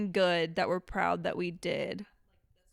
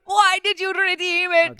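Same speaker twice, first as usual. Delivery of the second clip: slow, loud, much higher wail in a mock accent; mock Indian scam caller; 10 semitones higher, 10 dB louder, slower than usual. Imitating a scam caller lamenting, slow loud mock Indian accent. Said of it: "It's about those scam calls".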